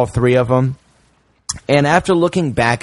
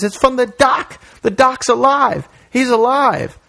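high, excited exclaim; excited Doc; 12 semitones higher than usual. Retold or imitated. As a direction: blurt it out in excitement. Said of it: "He says".

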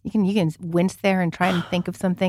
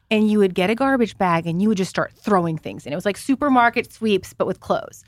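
ordinary talk

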